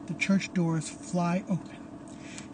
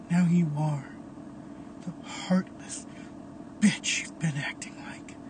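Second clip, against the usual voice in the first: cold whisper; 5 dB quieter than usual. Acted. Caleb standing over the dead Tara, contemptuous whisper.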